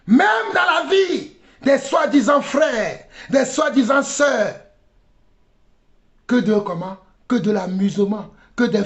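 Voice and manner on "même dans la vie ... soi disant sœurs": raised, higher-pitched rebuke; indignant sermon delivery; impassioned preaching. Real, not acted. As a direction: cry out from the pulpit. Preaches at full force.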